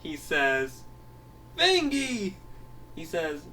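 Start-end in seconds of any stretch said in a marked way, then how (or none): 1.6-2.3 high-pitched angry shout of a name; angry call. Acted. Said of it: "he comes home angry at Fangy".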